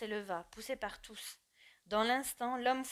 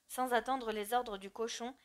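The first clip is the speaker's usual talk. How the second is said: usual speaking voice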